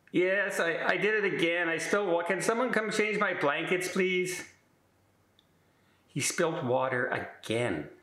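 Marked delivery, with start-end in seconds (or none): 0.1-4.4 high, apologetic plea; sheepish roommate. Retold or imitated. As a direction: confess the spill in a high, whiny voice. Roommate calls nurse again.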